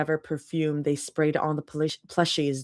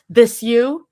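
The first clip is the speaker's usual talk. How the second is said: teasing; 5 semitones higher, 10 dB louder than usual. Acted. Rumi retaliating with raccoon.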